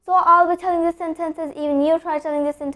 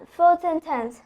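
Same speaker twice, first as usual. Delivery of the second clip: ordinary delivery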